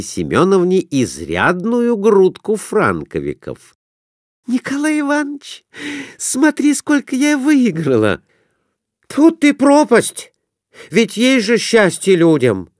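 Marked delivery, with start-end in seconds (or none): 4.5-8.2 high, thrilled squeal; wife calling husband to see her winnings; excited wife. A narrator's reading. 9.1-12.7 exclaiming. A narrator's reading. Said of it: "воскликнул Иван Кондратьевич".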